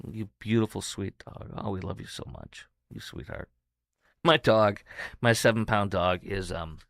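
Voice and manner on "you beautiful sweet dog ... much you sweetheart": soft, loving, much quieter; tender whisper. Real, not acted. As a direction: whisper it tenderly to a lost dog. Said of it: "I'm getting a little emotional".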